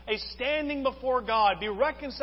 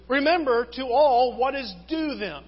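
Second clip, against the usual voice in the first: loud, weighty reading with "due" stressed; 3 semitones higher, 7 dB louder than usual. Shown as a demonstration. Preacher reads Romans to the congregation, stressing "due". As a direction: read it out firmly, leaning on "due". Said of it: "I'm gonna emphasize the word due".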